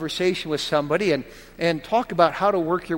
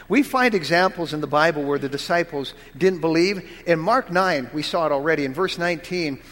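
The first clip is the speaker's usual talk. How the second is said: normal talking voice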